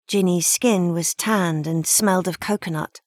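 ordinary delivery

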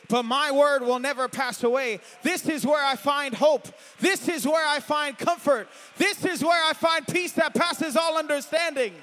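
loud, high preacher's cry building; shouting the word from the pulpit; full-force preaching. Acted. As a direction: preach it out to the whole church.

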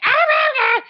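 high, loud mock yelling nonsense; hammed-up shout of nonsense syllables; idiot comedian yell. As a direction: bellow like a clueless comedian. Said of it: "a bunch of idiot comedians like".

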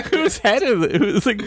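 high-pitched, talking through laughter; giggly and high; laughing. Real, not acted. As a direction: crack up while trying to get the question out. Cracking up at hat joke.

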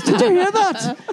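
high-pitched, badly faked Irish boy; attempted Irish accent. Host retells boy scaring his sister, mock Irish accent. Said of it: "That's not bad for a Dutch accent".

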